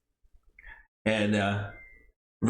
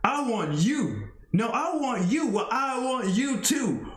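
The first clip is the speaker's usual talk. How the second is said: each I want you higher, last one shouted; escalating callouts; 8 semitones higher than usual. Acted. Two wrestlers trading challenges, building to a shout.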